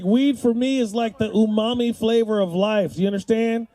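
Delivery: usual speaking voice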